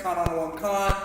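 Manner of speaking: "Khan Adawan Khan" run together quickly; "Khan Adawan Khan" at a brisk everyday pace; said fast. Shown as a demonstration. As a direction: rattle off "Khan Adawan Khan" as one word. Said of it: "used to saying it fast".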